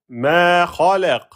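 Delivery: "Ma" drawn out before a short "khalaq"; prolonged vowel. Shown as a demonstration. Teacher demonstrating prolongation, "Ma" held long. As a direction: chant slowly, stretching "Ma".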